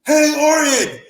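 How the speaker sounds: high, loud heckle that slows at the end; heckling fans yelling. Retold or imitated. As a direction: yell it up like a fan ripping into him. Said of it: "they were like".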